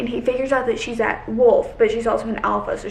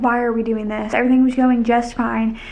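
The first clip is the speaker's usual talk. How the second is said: ordinary talk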